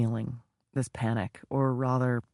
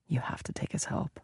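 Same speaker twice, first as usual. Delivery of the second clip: whisper; 3 semitones higher, 8 dB quieter than usual. Acted. Whirling thoughts, arguing with herself.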